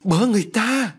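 high, horrified cry for help; terrified scream. A narrator's reading. Mother-in-law sees the stabbing, screaming in terror. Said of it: "bà kinh hoàng gào lên".

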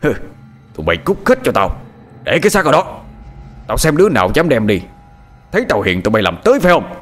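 menacing shout that rushes at the end; loud threat. Acted. Lão Tuất shouting at villagers to leave the body, menacing. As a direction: threaten the crowd, daring them. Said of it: "Hắn quát lớn".

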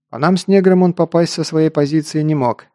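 plain reading voice

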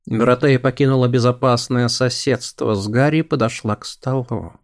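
plain reading voice

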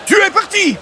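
high, gleeful takeoff cry; thrilled exclamation. Acted. Salesman describing flying away, high and gleeful. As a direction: launch it like a rocket.

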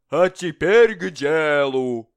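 slow, loud minister voice; pompous minister. Acted. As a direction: announce it slowly like a fat official. Prime minister voiced in story.

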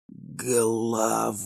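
faint, slow, eerie whisper. A narrator's reading. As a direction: breathe it out slowly, barely voiced. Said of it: "Тихий шёпот".

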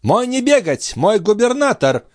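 high-pitched, stately proclamation; majestic Samor. A narrator's reading. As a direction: declare it grandly in a high servant's voice. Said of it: "величественно проговорил Самор".

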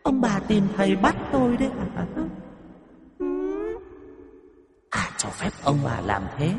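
high, eerie ghost taunting the in-laws; a vengeful ghost challenging the old couple; possessed voice. Acted. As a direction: sound like a spirit speaking through her. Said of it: "mà cất tiếng".

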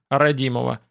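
ordinary delivery, nothing marked

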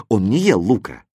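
usual speaking voice

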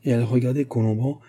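ordinary delivery, nothing marked

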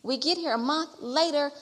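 usual speaking voice

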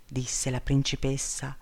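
ordinary delivery

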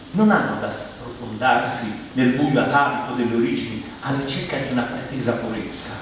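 normal talking voice

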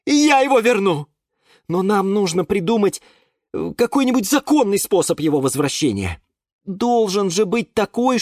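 high, urgent plea; Юра insisting. A narrator's reading. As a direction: insist anxiously on a lawful way. Юра ищет законный способ. (высокий голос, настойчиво)

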